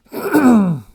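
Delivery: loud throat clear. Real, not acted. Alone before the retake.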